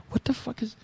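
high-pitched startled blurt; he gasps it out, caught off guard by the father, shocked; shocked outburst. Retold or imitated. Shocked at seeing father peeing.

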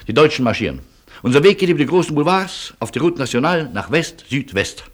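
urgent announcement. Acted. Urgent announcement of the escape route.

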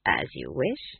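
low, soft acquiescence; light, understated compliance; said lightly. A narrator's reading. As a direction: give in lightly and quietly. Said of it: "said lightly".

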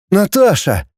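high-pitched, wounded protest; hurt, offended. A narrator's reading. Роман answers Наташа's accusation, offended. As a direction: protest her name, mildly hurt. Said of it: "с обидой вымолвил Роман".

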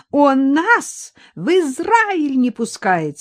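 high, slow despairing outcry; desperate old woman's cry. A narrator's reading. Granny cries out to Viktor in despair. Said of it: "с отчаянием выкрикнула старушонка".

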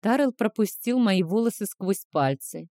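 plain reading voice

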